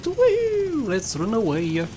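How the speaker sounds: ordinary talk, nothing marked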